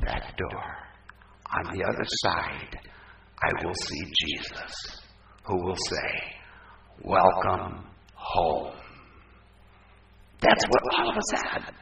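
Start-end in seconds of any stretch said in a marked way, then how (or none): 0.1-8.7 much lower and softer, slow; hushed and reverent. Real, not acted. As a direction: fade to a hush, tender. Quiet reverent vision of death.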